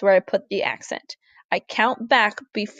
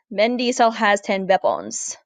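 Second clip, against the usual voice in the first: hammy German-Russian accented English; put-on German, Russian and English accent mash-up; mixed German-Russian-English accent. Shown as a demonstration. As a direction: say it like a mock German with a touch of Russian. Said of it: "You say it in kind of a mixed German, Russian, English accent".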